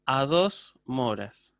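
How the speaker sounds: dos moras drawn out slowly, teacher stressing the count. Shown as a demonstration. Teacher to class, stressing the count slowly. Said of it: "teacher stressing mora count".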